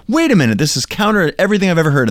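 high, fast startled protest; shocked objection. Pretend. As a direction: blurt it out, taken aback. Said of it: "You're like".

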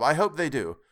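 father's keen agreement; crying out. A narrator's reading. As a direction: call it out with lifted pitch. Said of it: "cried Bob".

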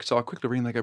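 normal talking voice

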